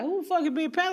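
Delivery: high-pitched indignant question; baffled retort. Retold or imitated. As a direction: act out confused disbelief. Retelling her baffled reply to host.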